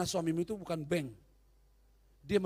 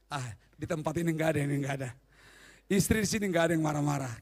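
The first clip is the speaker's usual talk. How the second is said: usual speaking voice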